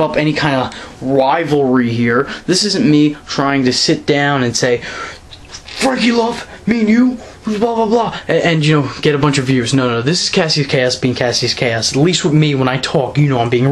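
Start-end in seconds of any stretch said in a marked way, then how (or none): 5.8-8.2 higher, mocking sing-song; mock challenge. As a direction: taunt him in a put-on voice.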